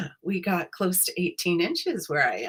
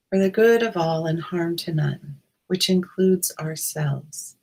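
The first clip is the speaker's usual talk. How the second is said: guided meditation. Acted. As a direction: ease listeners in, unhurried.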